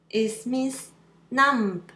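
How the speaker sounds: usual speaking voice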